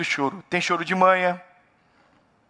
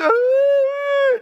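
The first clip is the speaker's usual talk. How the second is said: stretched pulpit cry; 6 dB louder than usual. Acted. Dramatic drawn-out pulpit cry.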